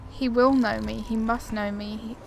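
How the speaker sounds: ordinary talk, nothing marked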